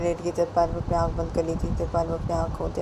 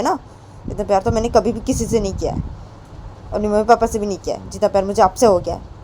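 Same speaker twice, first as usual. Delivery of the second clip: fast, slightly raised, earnest declaration; Payal, earnest confession; 4 semitones higher, 7 dB louder, faster than usual. Acted. Payal swears to Poonam her love is true, fast and earnest. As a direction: rush out the words to prove you mean them.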